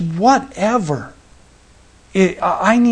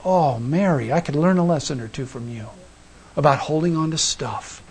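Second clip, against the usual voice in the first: much softer and lower, quick and intimate; tender inner thought; 5 semitones lower, 7 dB quieter than usual. Retold or imitated. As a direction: speak to Mary gently, almost to yourself. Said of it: "I just looked and I thought".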